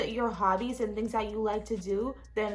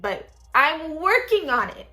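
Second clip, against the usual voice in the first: louder, higher, stretched-out "working"; emphatic insistence; 6 semitones higher, 8 dB louder than usual. Real, not acted. Vlogger insisting to viewers about going vegan, emphatically. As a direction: push "working" up high and loud.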